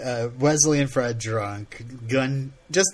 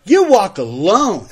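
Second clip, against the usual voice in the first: put-on slower, loud drunken repeat; belligerent drunk; 7 semitones higher, 10 dB louder than usual. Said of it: "That was my drunk Fred".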